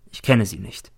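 usual speaking voice

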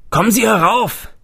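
high, loud call from upstairs. A narrator's reading. Old man calling up to visitor below, loud and high. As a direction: call it down the stairwell. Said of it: "rief ein Mann von oben".

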